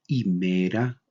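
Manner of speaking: "himera" starting on a bare EE vowel, H silent; pronunciación sin jota. Shown as a demonstration. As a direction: say "himera" as ee-MEH-ra. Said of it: "también se pronuncia como". ("himera" con hache muda e i inicial)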